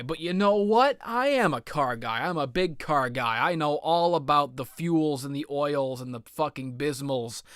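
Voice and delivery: usual speaking voice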